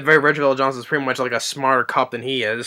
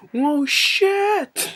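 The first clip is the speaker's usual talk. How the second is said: much higher, sudden surprised yelp; mock startled yelp. McClane seeing fire up the shaft, high startled yelp. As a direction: squeak it like fire just shot past. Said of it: "He's like".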